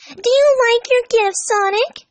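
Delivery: put-on high, slow, sweet girl voice; a bright hopeful girl asking; high sweet girl voice, hoping for a yes; hopeful Amy. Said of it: "she asked hopefully".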